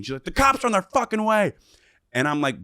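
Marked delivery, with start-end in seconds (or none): 0.2-1.5 high-pitched imitation of screaming; mock scream from the party's host; retold scream. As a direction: imitate her panicked yell, pitched way up. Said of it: "is screaming".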